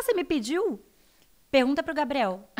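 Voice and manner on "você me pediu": innocent surprise. Retold or imitated.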